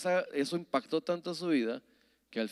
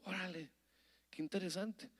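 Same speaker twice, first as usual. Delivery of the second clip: softer, musing to himself; quiet inner thought; 4 semitones higher, 13 dB quieter than usual. Retold or imitated. Pastor recalling his private reaction, quietly musing. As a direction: think it aloud under the breath. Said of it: "Y yo me quedé pensando".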